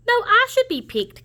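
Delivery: high squeaky turkey. Acted. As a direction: pitch way up, insistent. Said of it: "Cassie said".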